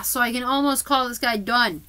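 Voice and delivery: ordinary delivery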